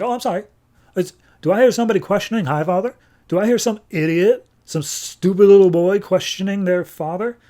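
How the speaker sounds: high, indignant sarcastic father; mock-apologetic scolding. Highfather belittles his son for questioning him, sarcastic scolding.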